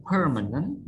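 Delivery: "permanent" stressed on the first syllable, PER. Shown as a demonstration. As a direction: hit PER at the start of "permanent". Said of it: "Stress on per".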